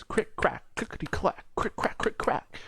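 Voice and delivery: high, unvoiced crick crack sounds; finger cracking noises. Recapping the anime's finger-breaking scene for viewers, high clicking snap noises. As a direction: click out the bone snaps in a whispery high voice. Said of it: "So he goes".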